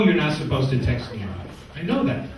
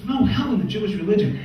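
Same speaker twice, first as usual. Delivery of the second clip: higher, outraged echo of the line; indignant disbelief; 7 semitones higher than usual. Retold or imitated. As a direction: repeat it back like you can't believe it. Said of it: "He goes".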